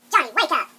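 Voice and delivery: loud sharp call to wake him; teacher calling out. Acted. Teacher to sleeping student in biology, loud call. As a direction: snap a dozing student awake.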